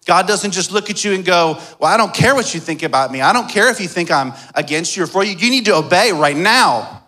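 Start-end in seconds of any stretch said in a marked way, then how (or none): 1.8-7.0 hurried, hammed-up bossy scolding; mock stern demand. Preacher playing an uncaring God to the congregation, mock stern. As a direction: imitate an impatient authority laying down the law.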